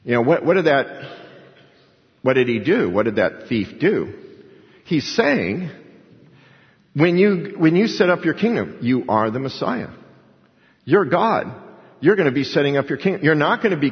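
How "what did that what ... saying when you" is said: ordinary talk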